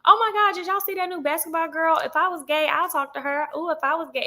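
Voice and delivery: high, giddy schoolgirl gush; mock swooning girl at school; gossipy high girls. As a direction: squeal it like a gossiping classmate.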